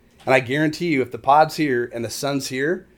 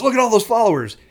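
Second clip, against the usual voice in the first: higher-pitched mock excited shout; excited angler; 9 semitones higher, 4 dB louder, faster than usual. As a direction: imitate an angler excitedly spotting fish. Mimics an angler spotting followers.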